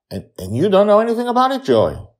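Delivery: put-on high, loud, fast child; a boy probing excitedly; eager child. A narrator's reading. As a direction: Charlie asks Joy, high and fast.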